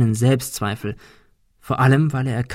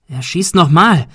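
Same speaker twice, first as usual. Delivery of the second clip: urgent, loud, high cry; alarmed shout; shouted warning; 6 semitones higher, 7 dB louder than usual. A narrator's reading. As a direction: yell a warning to the group. Warning of a gunshot.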